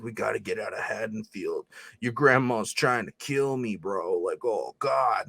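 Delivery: put-on low, muttered, moody teen voice; a mock gloomy mutter, dragged out at the end; dark mumbling Corey. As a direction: drop into a brooding slacker mumble. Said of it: "weird inflection to his voice where he's dark as shit".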